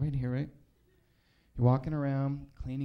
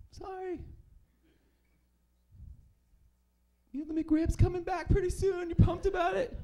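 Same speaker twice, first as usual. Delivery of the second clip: much higher, perky salesy voice; chirpy brand voice; 4 dB louder than usual. Retold or imitated. McDonald's answering the comic, in a perky high sales voice. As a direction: pitch the deal like a peppy mascot. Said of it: "McDonald's writes back".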